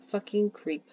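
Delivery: ordinary delivery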